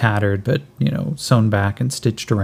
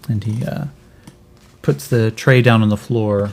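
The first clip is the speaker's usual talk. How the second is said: normal talking voice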